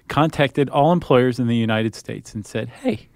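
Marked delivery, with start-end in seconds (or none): none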